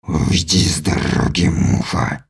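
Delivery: low, soft, hissing threat; menacing alien. A narrator's reading. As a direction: hiss it low like a predator. Alien voiced by narrator. (низкое угрожающее шипение)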